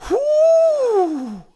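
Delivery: a long, high cry of being overcome; sky-high exclamation, near tears; high overwhelmed whoo. Retold or imitated. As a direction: let out a high whoo, emotion spilling over. Overcome with emotion at the film.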